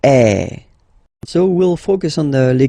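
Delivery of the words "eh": loud open eh; open French ê. Shown as a demonstration. Teacher recapping circonflexe sound. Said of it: "accent circonflexe".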